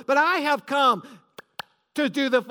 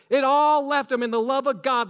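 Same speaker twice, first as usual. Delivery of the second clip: usual speaking voice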